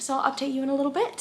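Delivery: usual speaking voice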